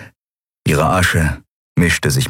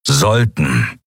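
echoing word; 3 semitones lower than usual. Acted. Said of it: "wiederholte Keru".

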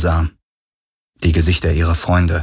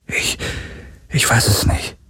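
hesitant, unvoiced whisper; halting breath of an answer; whispered uncertainty; 3 semitones higher, 4 dB quieter than usual. A narrator's reading. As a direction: whisper back, unsure. Whispered answer under threat.

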